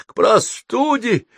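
high, slow, wheezy grumble; croaked complaint. A narrator's reading. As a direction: croak it out like someone worn out with a cold. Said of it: "прохрипел он". (хриплая жалоба)

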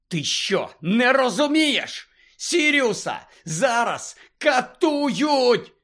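Harry yells that Sirius is being tortured; Harry shouting. A narrator's reading. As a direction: cry out in alarm, pushing hard. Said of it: "закричав Гаррі".

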